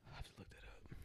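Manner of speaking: whispered aside to herself; whispering. Real, not acted. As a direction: whisper it under your breath. Aside while searching phone.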